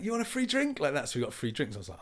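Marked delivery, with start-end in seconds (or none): 0.0-0.8 very high, excited offer; imitated eager offer. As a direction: sell the free drink cheerfully. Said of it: "like that, like".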